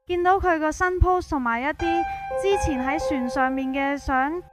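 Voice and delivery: ordinary delivery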